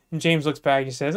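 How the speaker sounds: ordinary delivery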